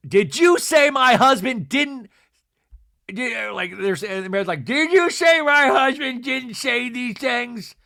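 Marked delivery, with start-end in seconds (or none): 0.1-2.1 loud, higher mock confrontation; Angie impression. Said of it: "Angie does her Angie move". 4.6-7.8 loud, high, slow mimicry; Meredith impression.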